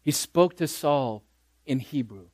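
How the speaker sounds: ordinary talk, nothing marked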